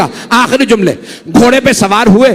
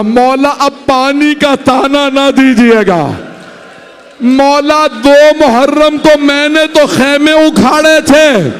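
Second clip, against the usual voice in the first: higher and slow, a grieving lament; anguished confession; 5 semitones higher, slower than usual. Acted. Hur confesses to Hussain about the thirsty children, high lament. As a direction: plead through grief, drawing the words out.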